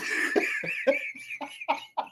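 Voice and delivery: real airy high laugh at the memory; helpless breathless giggle; high breathy laugh. Real, not acted. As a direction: burst into a silent wheeze of laughter. Caught stealing, locked eyes.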